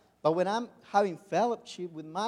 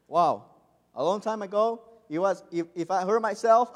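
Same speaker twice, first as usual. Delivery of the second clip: normal talking voice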